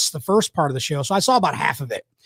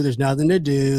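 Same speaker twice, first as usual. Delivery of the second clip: mock complaint; 2 semitones lower than usual. Mocking locals who say there's nothing to do, mock whine. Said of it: "people are always complaining about".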